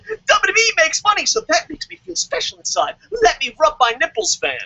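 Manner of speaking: whiny high parody of a self-satisfied fan; mocking fan. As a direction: sneer at the fans who cheer the company. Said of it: "not we worship the elite fucking fan".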